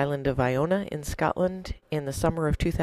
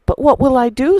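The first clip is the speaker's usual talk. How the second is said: near tears; 10 semitones higher, 12 dB louder than usual. Acted. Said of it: "big tears welling up in his eyes".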